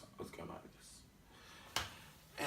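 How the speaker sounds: slow deflating whisper; fading down. Shown as a demonstration. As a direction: sink into a low whisper. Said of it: "year twelve was going like this".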